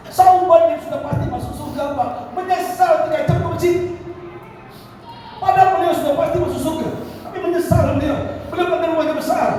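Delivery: high, insistent sermon delivery; impassioned preaching. Real, not acted. Preacher chides nominal Muslims far from mosque, impassioned preaching. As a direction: ring out each phrase high for the crowd.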